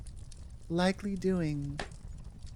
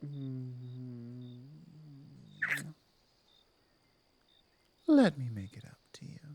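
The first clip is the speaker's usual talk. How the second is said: apologetic werewolf pack leader; 4 semitones lower than usual. Acted.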